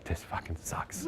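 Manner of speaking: low, breathless muttered whisper with a laugh; whispered curse. Retold or imitated. Lying in bed at night, whispering a bitter curse. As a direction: hiss it under the breath in the dark.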